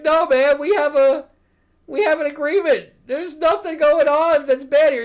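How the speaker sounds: high, loud imitation of a desperate appeal; hammed-up frantic reasoning; mock protest. Acts out Hook reasoning with Peter.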